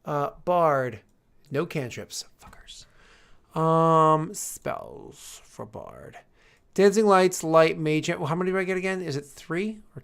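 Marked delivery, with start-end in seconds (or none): 1.5-2.8 whispered. Real, not acted. Disappointed muttering. 4.3-6.2 whispered. Real, not acted. Muttering while searching.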